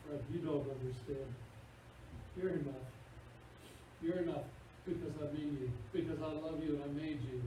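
tender voice of God. Retold or imitated. God answering Fred.

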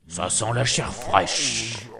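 slow, dragged-out ogre growl; ogre sniffing. Acted. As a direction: sniff out the child menacingly. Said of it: "s'écria-t-il". (ogre lent et menaçant)

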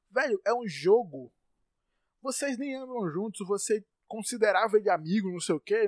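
usual speaking voice

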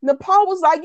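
ordinary talk

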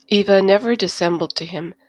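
ordinary delivery, nothing marked

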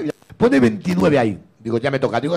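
usual speaking voice

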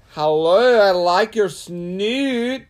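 loud, very drawn-out cartoon accent; Dexter's Laboratory accent. Greeting the dog's snoot, loud cartoon accent. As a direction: ham up a cartoon accent, high and slow. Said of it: "It's like a Dexter's Laboratory accent".

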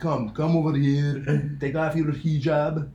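put-on slow, loud Yiddish-accented teacher. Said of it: "a Yiddish, Yiddish accent?".

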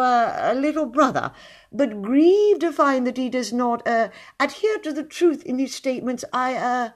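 high, hesitant, simpering suitor; fawning poet. Acted. Poet courting Ethel in a high, halting, simpering voice. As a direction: woo her with nervous, halting politeness.

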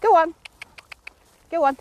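loud, high urging; calling out. Real, not acted. Trainer urging the horse, loud high call. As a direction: keep the horse moving with a firm call.

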